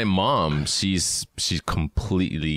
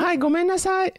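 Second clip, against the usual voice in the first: very high, loud falsetto apology; squeaky falsetto; 4 dB louder than usual. Pretend. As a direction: squeal it like a cartoon. Mock phone call, high squeak.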